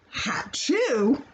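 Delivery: ordinary delivery, nothing marked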